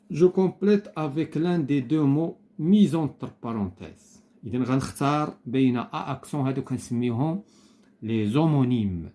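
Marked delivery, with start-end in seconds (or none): 0.1-4.2 instruction read aloud. Shown as a demonstration. Teacher reads exercise instruction aloud.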